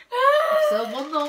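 high, slow, strained laughing boast; whiny laughing boast. Real, not acted. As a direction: stretch it out in a wailing laugh. Gloating after swallowing gross food.

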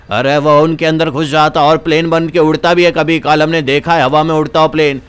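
put-on low old man cautioning visitors; low-pitched elder character; warning old villager. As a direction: play an old local warning of mortal danger.

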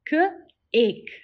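K-ake split into onset and long vowel; segmented. Shown as a demonstration. Teacher sounding out K-ake for the class. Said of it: "Say it with me again".

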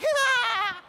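very high loud delighted squeal; baby squeal. Retold or imitated. Baby daughter squealing back at her dad, delighted. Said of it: "And she went".

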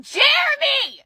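high, slow shout on the name; angry shout. Real, not acted. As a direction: spit the name out at full voice. Screaming fight with her mother.

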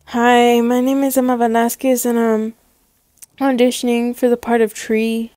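worn-down auditioner. Acted. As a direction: introduce yourself with fading hope. Repeated auditions wear her down.

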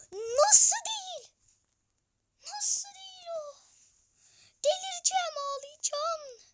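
çok yüksek, inceltilmiş yalandan ağlama sesi; ağlamaklı isyan. Pretend. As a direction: sahte bir ağlamayla sızlan. Kaçan gole ağlamaklı isyan. (kaçan gole inceltilmiş yalandan ağlama)

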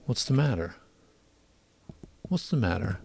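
hushed, troubled question, asked twice; stuttering. A narrator's reading. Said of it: "He stuttered".

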